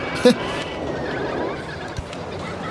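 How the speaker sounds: breathless high wheeze of laughter; silent wheezing laugh. Real, not acted. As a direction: laugh with no voice, just air. Laughs at cash machine joke.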